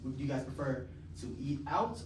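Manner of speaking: ordinary talk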